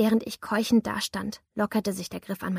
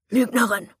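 louder, harsh and cutting; snarled accusation; 7 dB louder than usual. A narrator's reading. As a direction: spit the word at her. Attacker cuts her off.

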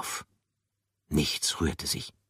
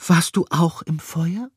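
gentle character voice; 12 semitones higher, 7 dB louder than usual. Acted. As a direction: ask kindly.

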